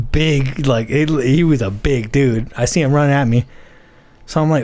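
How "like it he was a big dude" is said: ordinary delivery, nothing marked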